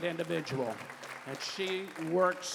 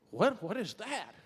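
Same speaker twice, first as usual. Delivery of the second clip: higher-pitched startled question; startled burglar; 4 semitones higher than usual. Acted. Burglar hears a voice in the house, startled. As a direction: pitch it up, suddenly puzzled and alarmed. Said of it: "He goes".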